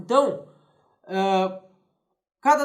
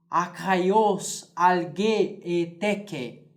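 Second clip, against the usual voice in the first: slow solemn recitation of Greek; reciting Greek verse; much slower than usual. Shown as a demonstration. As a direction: declaim the Homeric lines slowly. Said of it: "Eu tenho assim".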